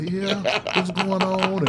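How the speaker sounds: high, drawn-out show of sympathy; over-interested, stretched-out questioning; feigned concern. Retold or imitated. Acting someone preying on the vulnerable.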